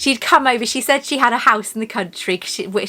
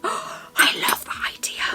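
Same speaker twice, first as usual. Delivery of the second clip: gasp, then an excited whisper; delighted whisper; 5 semitones higher, 7 dB quieter than usual. Real, not acted. Vlogger planning to crochet a tea cozy, delighted whisper. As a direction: whisper it, thrilled by the idea.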